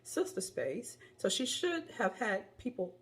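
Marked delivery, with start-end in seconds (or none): none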